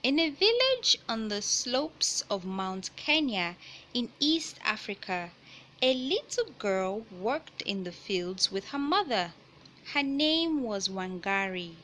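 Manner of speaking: picture book read-aloud voice; reading to children. Acted. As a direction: clear storybook narration.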